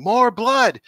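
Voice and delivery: high, loud mock scream; imitated director's scream. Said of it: "the director's screaming".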